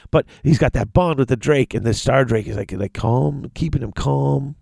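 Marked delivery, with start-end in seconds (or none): none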